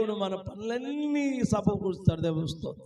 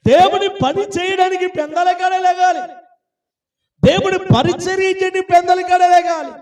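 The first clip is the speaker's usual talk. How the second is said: raised, ringing exhortation; full-force preaching; 9 semitones higher, 7 dB louder than usual. Real, not acted. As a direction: drive the command home at high pitch. Exhorts the congregation. (గట్టి స్వరంతో ప్రబోధం)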